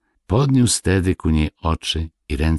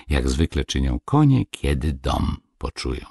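plain reading voice

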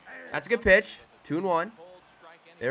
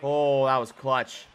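normal talking voice

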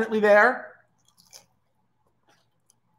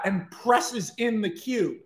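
plain reading voice

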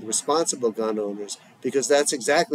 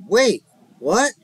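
startled what; 9 semitones higher, 5 dB louder than usual. Acted. Bedazzled grandfather reacts.

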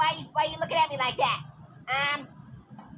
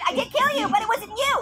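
ordinary talk

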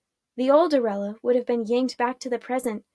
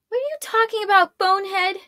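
high, fast, loud retort; irritated comeback in a high voice; muttered irritation; 10 semitones higher, 6 dB louder, faster than usual. A narrator's reading. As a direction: throw it back quickly, pitched way up. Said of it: "muttered Arella".

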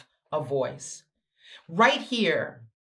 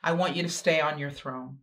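ordinary delivery

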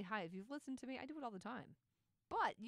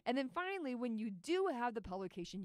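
ordinary talk, nothing marked

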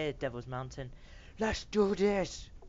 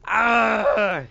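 sharp high yell of defeat; loud anguished cry; 5 semitones higher, 11 dB louder than usual. Real, not acted. Gamer losing the fight lets out a loud anguished yell. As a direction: cry out as you lose.

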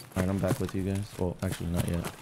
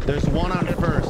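loud, slow enemy callout; 6 semitones higher, 7 dB louder, slower than usual. Real, not acted. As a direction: call it out to the squad. Calling enemy position to squad.

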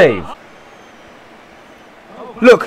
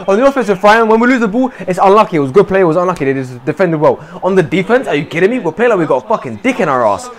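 normal talking voice

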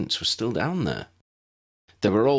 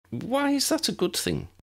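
put-on higher, puzzled young girl. A narrator's reading. Lydia questions Tessa's taste in boys, puzzled higher voice. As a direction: ask it genuinely baffled.

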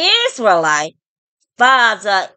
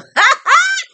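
high scornful cackle. Real, not acted. Scorning her critics, high cackle.

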